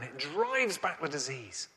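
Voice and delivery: normal talking voice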